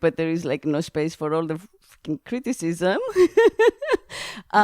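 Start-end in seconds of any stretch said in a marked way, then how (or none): 3.0-4.3 bright high laughter. Real, not acted. Laughing about escaping family criticism. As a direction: laugh after the curse.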